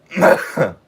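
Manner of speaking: real chesty cough. Real, not acted. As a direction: cough hard, sick. Sick, coughing mid-talk.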